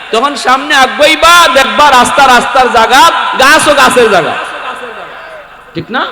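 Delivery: high, loud rhetorical challenge; full-force preaching. Real, not acted. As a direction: preach it out loudly to the crowd.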